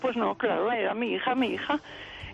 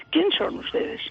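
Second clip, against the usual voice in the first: neighbour challenging; 6 semitones higher than usual. Retold or imitated. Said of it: "la chica cogió el teléfono".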